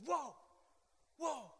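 high, drawn-out astonished whoa; stunned after the vision; amazed whoa. Retold or imitated. Man waking from his vision, astonished and high.

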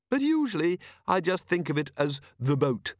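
high, modest afterthought; mild eccentric inventor. A narrator's reading. Inventor admits his simpler name, mild. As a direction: add it lightly in a high voice. Narrator voices the inventor Leonard.

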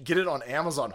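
ordinary talk, nothing marked

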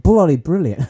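loud, high hammed-up British "Bloody brilliant"; British accent. Topping a friend's mock Brit, loud British accent. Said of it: "She hates that I do accents".

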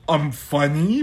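lightly voiced self-praise; silly put-on voice. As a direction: say it in a goofy voice. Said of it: "I gave him a voice".

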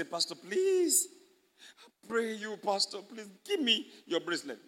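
mock begging with a drawn-out please; high-starting, exaggerated plea; pleading. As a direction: beg like a needy church member. Acted begging for bracelet.

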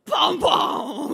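loud booming elephant stomps; elephant warning. Retold or imitated. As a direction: boom it out like a huge elephant. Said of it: "big aunties at the back going".

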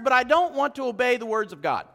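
rising sermon voice, pressing the point; impassioned preacher pressing the congregation; preaching. Acted. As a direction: preach it with building force. Preaching at full force.

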